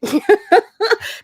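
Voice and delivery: high loud laugh; bright burst of laughter. Real, not acted. Host laughing after reassurance.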